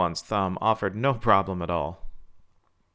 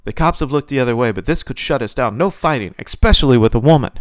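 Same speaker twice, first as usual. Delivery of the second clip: loud, urgent protest, pitched up; shouted; 4 semitones higher, 11 dB louder than usual. A narrator's reading. Promoter forbids fighting with a woman, shouting urgently. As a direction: lay down the law at a shout. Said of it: "the promoter shouted".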